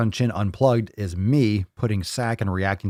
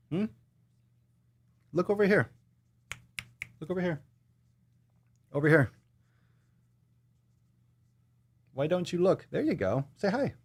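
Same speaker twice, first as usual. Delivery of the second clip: pet talk; 8 semitones higher, 6 dB quieter than usual. Real, not acted. Owner urging the dog to look at the camera, high pet talk. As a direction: coax the pet to turn and look.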